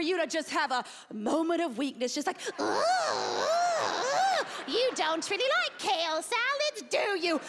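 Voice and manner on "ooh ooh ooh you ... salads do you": high, drawn-out oohs into taunting temptation; demon stalker. Acted. Demon stalker tempts a dieter off salad, high taunting voice. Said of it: "it's like a demon stalker waiting in the shadows".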